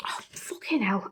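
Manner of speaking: whispered swear under the breath; muttered curse. Real, not acted. Reader whispering a curse at the floppy book. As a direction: hiss the curse to yourself, unheard.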